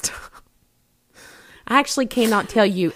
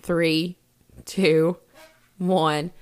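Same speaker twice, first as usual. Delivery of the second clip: normal talking voice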